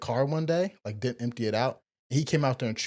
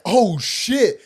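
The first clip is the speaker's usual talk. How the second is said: loud, high startled exclamation; shocked oh shit; 11 semitones higher, 10 dB louder than usual. Retold or imitated. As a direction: gasp it out in disbelief. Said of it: "I'm like".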